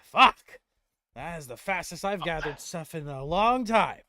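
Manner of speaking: loud, high thrilled outburst; excited exclaim. Acted. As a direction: blurt it out thrilled. Dwarf marvels at his speed.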